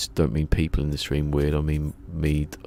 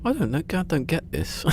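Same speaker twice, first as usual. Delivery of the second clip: normal talking voice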